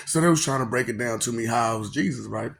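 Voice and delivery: ordinary talk, nothing marked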